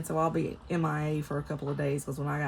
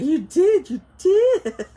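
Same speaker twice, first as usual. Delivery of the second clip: high, loud mock little-girl accusation; toddler accusation; 12 semitones higher, 12 dB louder, slower than usual. Granddaughter accusing grandma, high mock outrage. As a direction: pipe up like an indignant little granddaughter.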